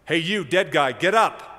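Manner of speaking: Jesus commanding a dead man, loud shout. Acted. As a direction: bark the command with full authority. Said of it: "With authority".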